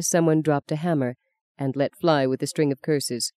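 usual speaking voice

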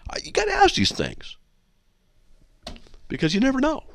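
very high-pitched defensive squeak; mock innocence. Host excusing his own quibbles, mock-innocent.

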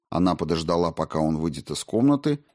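ordinary delivery, nothing marked

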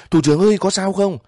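very high, loud, quick shout. A narrator's reading. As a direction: yell down from the truck cab. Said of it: "lái xe quát xuống".

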